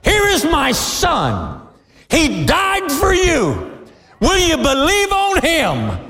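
slow, lightly voiced divine invitation; a hint of God's voice in a shouted plea; God's gentle plea. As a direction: plead slowly as God offering his son. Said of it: "come to you and say".

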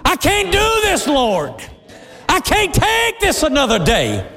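very high, childlike mock wail; whining complaint. Believer telling the Lord it's too much, whining.

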